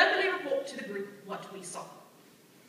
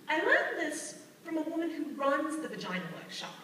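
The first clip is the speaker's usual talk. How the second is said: ordinary talk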